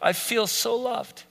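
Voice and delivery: soft, slow, tender gratitude; touched wife. Retold or imitated. Wife coming home to a clean kitchen, tender and soft. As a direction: melt a little as you say it. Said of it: "she'll be like".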